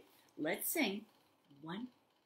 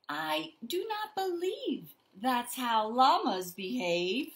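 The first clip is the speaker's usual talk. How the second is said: slow, louder storytelling; reading to children; 6 semitones higher, 5 dB louder, much slower than usual. A narrator's reading. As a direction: read it like a storybook to little ones. Reads picture book aloud.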